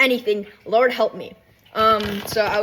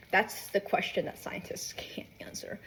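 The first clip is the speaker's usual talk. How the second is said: slow, hushed pondering; mock-mysterious whisper; 4 semitones lower, 12 dB quieter than usual. Real, not acted. Musing whether they are dating, hushed mystery. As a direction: whisper it slowly like a great mystery.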